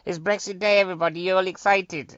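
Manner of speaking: high, loud excited call to the crowd; hyped announcement. Pretend. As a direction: whip the audience up. Hyped opening call to the audience.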